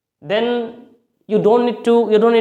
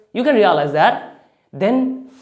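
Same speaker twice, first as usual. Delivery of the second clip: ordinary talk, nothing marked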